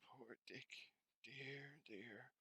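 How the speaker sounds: soft, sorrowful sigh; wistful. Acted. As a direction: let it fall away sadly. Scrooge wistful.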